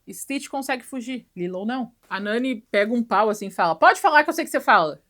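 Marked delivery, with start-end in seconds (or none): 3.7-5.0 loud, high challenge; hammed-up threatening yell; demanding shout. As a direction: shout him down, stick raised. Nani confronts Stitch with stick.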